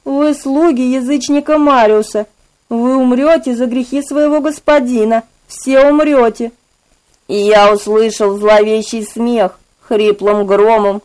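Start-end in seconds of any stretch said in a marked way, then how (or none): 0.0-6.5 cold, high, otherworldly menace; mustache twirling villain. Acted. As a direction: pronounce doom like an eerie judge. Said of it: "сказал холодный, сверхъестественный голос".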